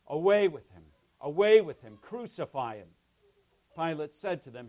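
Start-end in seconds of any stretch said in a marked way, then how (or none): 0.1-2.9 loud, higher-pitched narrated outcry; crowd crying out. A narrator's reading. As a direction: cry it out forcefully as the angry crowd. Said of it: "But they cried out".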